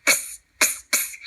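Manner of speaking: unvoiced psst through a hole; whispered psst. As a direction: hiss it secretly. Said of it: "He whispered through a hole".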